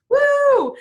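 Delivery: high, loud celebratory woo; woo cheer. Real, not acted. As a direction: whoop it up. Cheering after announcement.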